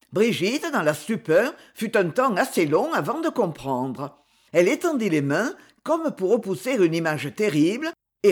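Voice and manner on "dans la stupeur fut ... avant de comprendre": usual speaking voice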